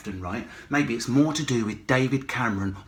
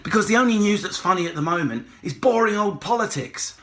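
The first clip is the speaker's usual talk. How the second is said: ordinary delivery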